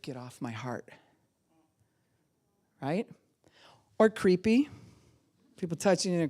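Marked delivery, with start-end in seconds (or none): 0.0-0.9 quiet warning. Retold or imitated. Inner reaction to dominating touch.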